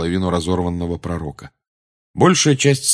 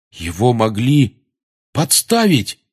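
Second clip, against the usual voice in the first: slow build to a high exclamation; exclaiming; 4 semitones higher, 3 dB louder, much slower than usual. A narrator's reading. Ron realising it was a frame-up, exclaiming. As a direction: burst out on the final word. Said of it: "воскликнул Рон".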